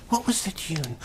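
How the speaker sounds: breathy half-whispered musing to himself. Acted. Faun whispering to himself while searching his memory. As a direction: whisper it as you try to recall.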